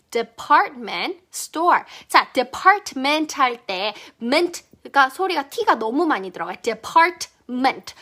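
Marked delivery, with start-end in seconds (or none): 0.1-1.8 department store very slow, store stressed; slowly again. Shown as a demonstration. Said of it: "다시 천천히". 6.6-7.9 department very slow, the T before ment dropped; department without T. Shown as a demonstration. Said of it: "T를 과감히 버려주세요".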